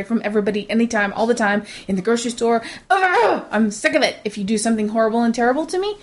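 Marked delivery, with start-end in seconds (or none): 2.9-3.5 exasperated groan. Real, not acted. Fed up with grocery store apologies, exasperated groan. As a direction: let out a fed-up "ugh", pitched way up. Ranting about constant apologies.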